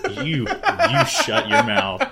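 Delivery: slow, loud, high telling-off through laughter; mock indignant scolding. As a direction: play offended, drawing out each word. Mock defence of favourite character.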